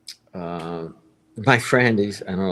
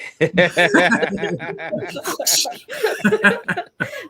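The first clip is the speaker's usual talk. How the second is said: loud laugh; 5 dB louder than usual. Real, not acted. Host laughs at the joke.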